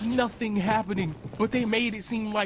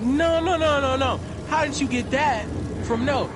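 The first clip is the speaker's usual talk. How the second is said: higher, louder protesting no no; flustered denial; 6 semitones higher, 3 dB louder than usual. Retold or imitated. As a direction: panicky denial piling up. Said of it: "I was like".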